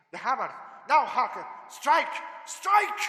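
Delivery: loud, high rising cry to strike; commanding shout. Acted. Van Helsing screaming at Harker to drive the stake.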